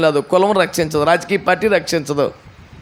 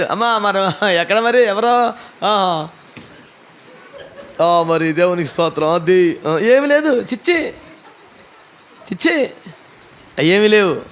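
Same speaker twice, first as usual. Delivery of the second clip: hesitant evasive mumbling; slower than usual. Retold or imitated. Bumbling evasive believer.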